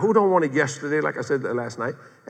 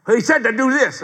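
high, louder exasperated retort; raised-pitch mock annoyance; a little mad; 9 semitones higher, 5 dB louder than usual. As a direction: imitate a wife snapping back, fed up. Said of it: "she got a little mad".

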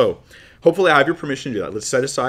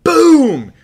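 loud, high imitated gun blast; shouted gunshot boom; 12 dB louder than usual. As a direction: belt out a sudden bang. Imitates the gunshot after trigger.

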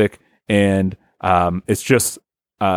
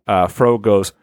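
ordinary talk, nothing marked

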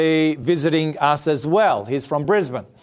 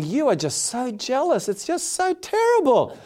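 high, mock-outraged inner voice; indignant thought; 6 semitones higher, 3 dB quieter than usual. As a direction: scoff at her jealousy. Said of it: "And I'm thinking".

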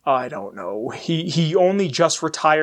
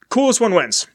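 normal talking voice

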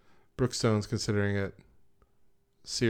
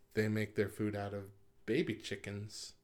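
ordinary talk, nothing marked

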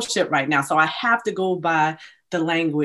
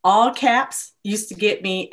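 ordinary talk

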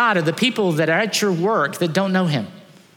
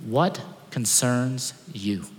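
soft question; 6 semitones lower, 6 dB quieter, much slower than usual. Acted. Slow searching question to congregation.

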